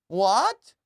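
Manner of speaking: startled yelp; shocked what. Real, not acted. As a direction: react like you can't believe it. Shocked at machete alert.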